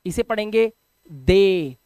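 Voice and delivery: teacher slowly models दे for the class; slow pronunciation demo. Shown as a demonstration. Teacher slowly says दे for students learning English.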